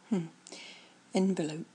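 ordinary talk, nothing marked

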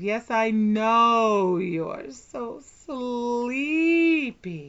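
slow, stretched hypnotic drawl; slow drawn-out. Acted. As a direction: stretch every word out lazily. Repeated line, slower take.

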